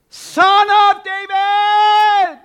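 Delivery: long drawn-out piercing cry, then dropping away; desperate scream. Acted. Blind beggar screaming to Jesus for mercy, piercing cry. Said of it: "he screams his head off".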